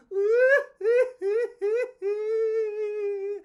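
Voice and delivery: high loud squealing laugh; crying laugh. Real, not acted. Storyteller bursting into laughter before the last story.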